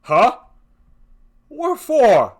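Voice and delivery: loud, high, alarmed question; sharp demand. Acted. Husband startles at his wife's words, loud alarmed question.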